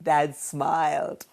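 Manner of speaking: usual speaking voice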